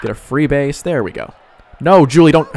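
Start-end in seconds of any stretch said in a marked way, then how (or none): 1.8-2.5 frustrated shout. Real, not acted. Yelling at his player in game.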